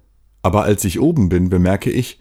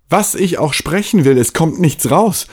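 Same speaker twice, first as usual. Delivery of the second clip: rising panic; 8 semitones higher than usual. Acted. Panic at losing speech.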